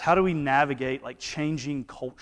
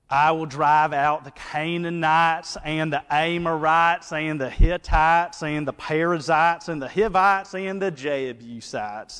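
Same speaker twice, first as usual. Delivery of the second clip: mock small-town Southern accent, louder; imitating thick Alabama drawl; 4 semitones higher, 5 dB louder than usual. Reading the passage in small group, a mock Ohatchee drawl. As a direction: drag out the vowels like Ohatchee. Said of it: "it would go something like".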